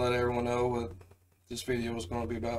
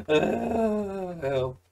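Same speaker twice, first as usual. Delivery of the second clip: stuck person calling; 9 semitones higher, 3 dB louder than usual. Retold or imitated. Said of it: "It's just like".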